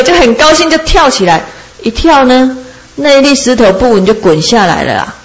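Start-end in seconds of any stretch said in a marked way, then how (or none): none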